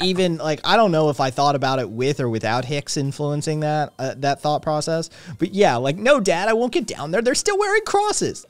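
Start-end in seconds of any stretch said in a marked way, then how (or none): 6.0-8.5 high-pitched, rising protest to a father; protesting son. Acted. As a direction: plead upward like a reluctant son. Acting Jesus refusing to return.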